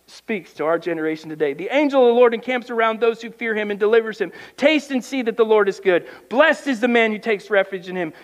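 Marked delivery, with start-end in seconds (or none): 1.5-8.1 raised, quick psalm reading; preaching scripture. Acted. Preacher reading a psalm to his congregation, raised and fervent. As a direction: push the verses out with fervour.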